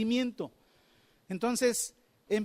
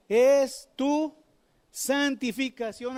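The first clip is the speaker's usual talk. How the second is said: proclaimed verse; 4 semitones higher, 5 dB louder, much slower than usual. Acted. Preacher proclaims a Bible verse.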